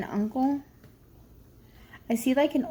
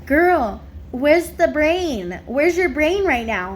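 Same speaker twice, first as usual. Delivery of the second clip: louder and higher, slow teasing scold; mock scolding; 4 semitones higher, 5 dB louder, much slower than usual. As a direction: scold her like a sassy friend. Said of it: "He goes".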